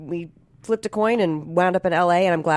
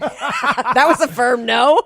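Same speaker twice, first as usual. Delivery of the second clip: laughing; 5 semitones higher, 4 dB louder, much slower than usual. Real, not acted.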